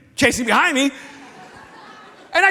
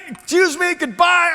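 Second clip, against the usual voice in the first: curt angry goodbye; 6 semitones higher, 4 dB louder than usual. Retold or imitated. Storming off angrily.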